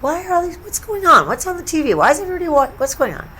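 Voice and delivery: high, puzzled questions; baffled voice rising up high; confused. Retold or imitated. Walking through the airport lounge, high baffled questions. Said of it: "I'm like".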